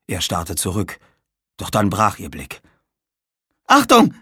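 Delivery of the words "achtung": loud, high shriek of warning. Acted. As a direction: scream the warning. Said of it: "kreischte Tamara".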